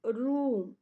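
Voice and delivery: रूम with a stretched long oo, slowed down. Shown as a demonstration. Teacher models the long vowel for students, drawn-out oo. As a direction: lean on the ऊ in रूम and hold it. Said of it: "ऊ के ऊपर हम जोर दे रहे हैं ना रूम".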